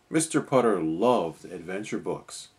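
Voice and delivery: plain reading voice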